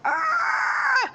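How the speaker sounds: very high, loud cartoon shriek; mock scream. Storyteller voicing startled characters, high mock scream. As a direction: let out a high pretend scream. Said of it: "they fucking scream".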